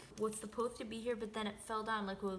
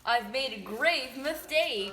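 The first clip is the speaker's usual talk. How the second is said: dramatic lament; 2 semitones higher, 10 dB louder, slower than usual. Pretend.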